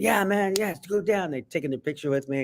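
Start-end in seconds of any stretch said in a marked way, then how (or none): 0.0-1.3 excited gangster. Retold or imitated. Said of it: "they became children".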